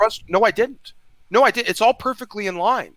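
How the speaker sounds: heated objection in a debate; frustrated. Real, not acted. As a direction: push back hard, voice up. Heated argument with Snake.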